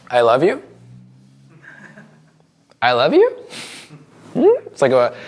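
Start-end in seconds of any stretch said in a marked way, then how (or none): 0.1-0.6 YOU lifted up like a question; rising question tone. Shown as a demonstration. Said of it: "I'll go up first". 2.8-3.3 higher, with YOU swept up questioningly; rising question tone. Shown as a demonstration. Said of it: "I'll go up first".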